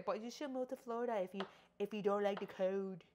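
quick, lightly voiced know-it-all commenter; mocking commenter. Mimicking a viewer telling her to move to Florida, fast mock voice. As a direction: rattle off the advice like a smug commenter, fast. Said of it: "comment down below and say".

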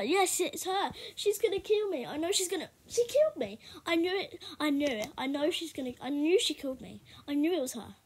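excited realisation. Real, not acted. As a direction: exclaim it, caught out and thrilled.

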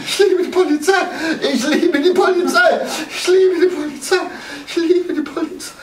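high, laughing, giddy with relief. Acted. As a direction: laugh it out, saved at last. Giddy relief after being rescued.

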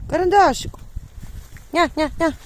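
high, loud call across the yard; calling the dog. Real, not acted. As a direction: call your dog from far away. (голосно кличе собаку)

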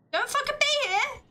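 mock yell. Player yelling at the ghost to leave, loud and high. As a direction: yell it at the ghost, half playing.

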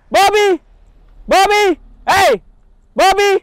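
high, loud repeated calls; shouting the bird's name. Real, not acted. Owner yelling for his bird flying too far, high and loud. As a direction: yell him back again and again. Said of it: "saya pasti teriak-teriak manggil dia".

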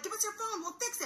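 high-pitched imitation of kids; pleading kids. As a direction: put on an insistent, high kid voice. Kids offering help, retold.

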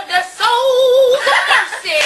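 "souls" stretched long, laughing; slow, sing-song "souls"; dramatic emphasis. Real, not acted. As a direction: draw out "souls" for comic drama. Comic retelling with stretched phrase.

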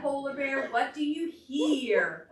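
storybook chant; reading to children. Acted. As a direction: chant the book's refrain for toddlers. Reads picture book to children.

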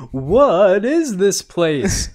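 high, loud, drawn-out mock wonder; imitating Mario's bewilderment. Retelling Mario waking up in the Mushroom Kingdom, mock bewilderment. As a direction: stretch it out slowly, hammed-up astonishment. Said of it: "And he's like".

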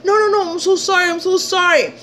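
high, rushed mock pleading; frantic apologizing. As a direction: imitate him apologizing in a high panicky voice. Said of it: "he's apologizing".

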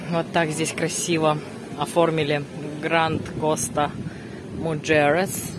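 normal talking voice

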